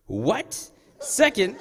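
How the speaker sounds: high, loud, incredulous echo; startled, acted-out question; mock disbelief. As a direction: play a kid stunned by the instruction. Child stunned by next gear.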